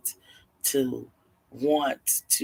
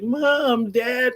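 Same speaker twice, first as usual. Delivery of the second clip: high, drawn-out cry for parents; mock crying; 10 semitones higher, 4 dB louder than usual. Freed kids call to their parents, imitated crying. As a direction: wail it high, imitating upset children. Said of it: "they're crying".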